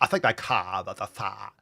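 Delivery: hammed-up British vowels, CARE as "cah"; mock British accent. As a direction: parrot her British accent mockingly. Parrots the clip speaker's British accent.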